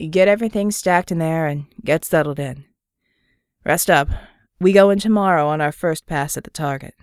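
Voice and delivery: lazy, confident Southern greeting. Acted. Murdoch gives orders.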